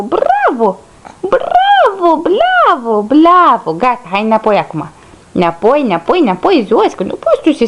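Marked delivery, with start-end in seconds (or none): 0.0-3.7 cheering praise. Real, not acted. Praises the baby standing up.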